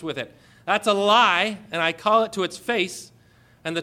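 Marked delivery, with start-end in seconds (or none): 0.6-3.1 loud, higher declaration; emphatic sermon denunciation; forceful denunciation. Real, not acted. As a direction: call it out boldly to the crowd. Preacher denounces the lie.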